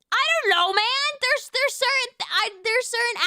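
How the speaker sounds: flustered and insistent, pitched well up; exasperated defence. Real, not acted. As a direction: throw up a high-pitched defence. Still defending wanting to eat accents.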